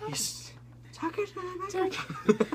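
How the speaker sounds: squeaky, drawn-out comic catchphrase voice; silly falsetto impression; Saturday Night Live bit. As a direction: quote a sketch in a very high, slow voice. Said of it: "If any of you guys watch Saturday Night Live".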